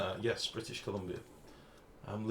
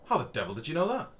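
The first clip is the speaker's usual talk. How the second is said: posh British exclamation; 6 semitones higher, 4 dB louder than usual. Retold or imitated. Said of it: "And I went".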